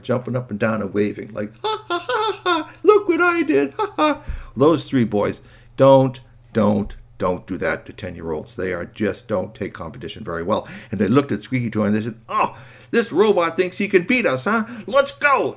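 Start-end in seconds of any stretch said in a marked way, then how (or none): 1.6-4.2 very high showing-off cackle; silly, full of himself. Acted. Toy robot jumping and waving at the boys, cocky. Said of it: "full of himself". 12.3-15.6 high indignant challenge, building to a loud cry; competitive boys. Acted. Boys refusing to be beaten by a robot, indignant.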